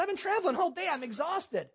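high mock whine, sliding down at the end; whining. Husband exhausted from traveling all day, whining in protest. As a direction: imitate a worn-out husband complaining. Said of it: "The guy whined about it".

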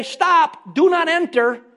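loud, high-pitched commanding notice; warning sign. Acted. Imagined church door sign warning people entering, commanding. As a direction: call it out like a posted warning. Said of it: "put a sign outside the front door".

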